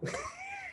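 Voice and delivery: short high-pitched laugh. Real, not acted. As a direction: burst out laughing, high and brief. Laughs about getting fired.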